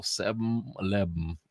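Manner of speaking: "seven eleven" drawn out as "sebm... lebm"; Southern pronunciation. Retold or imitated. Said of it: "How do you spell".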